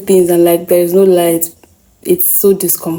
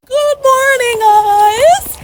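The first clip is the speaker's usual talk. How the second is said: swollen face; 2 semitones higher than usual. Real, not acted. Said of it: "My face is swollen".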